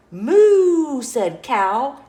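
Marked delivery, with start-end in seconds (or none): 0.1-1.0 loud, high drawn-out moo; cow moo. A narrator's reading. Cow asking to come in, loud moo.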